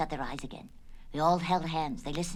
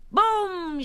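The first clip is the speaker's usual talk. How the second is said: high, loud firework boom; shouted explosion; 14 semitones higher, 9 dB louder than usual. Acted. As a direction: shout it like a firecracker going off. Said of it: "shouted Dolly".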